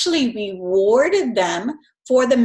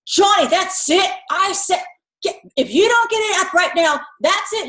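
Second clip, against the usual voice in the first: yelling mom; 7 semitones higher than usual. Retold or imitated. Mom finally yelling at Johnny over the toys, mock yell. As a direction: lose patience and yell at Johnny. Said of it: "Now you're yelling".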